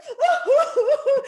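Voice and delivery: very high, loud laughing woos; ecstatic woo. Retold or imitated. Author whooping to her daughter, overjoyed. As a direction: whoop with joy through laughter.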